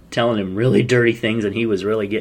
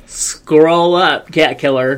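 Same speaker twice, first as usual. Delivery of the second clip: louder mock put-down; loud retort; 4 semitones higher, 6 dB louder, much slower than usual. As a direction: snap back, loud and mocking. Mock retort to flaky guy.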